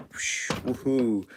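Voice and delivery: slow, drawn-out gleeful woohoo. Acted. Kid flying over rooftops, slow gleeful cheer.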